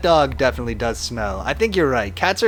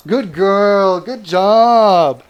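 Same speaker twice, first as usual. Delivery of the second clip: loud, high, drawn-out praise; praising a dog; 5 semitones higher, 7 dB louder, much slower than usual. Real, not acted. Owner rewards the dog for giving paw, gushing praise. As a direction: stretch it out high and proud for the dog.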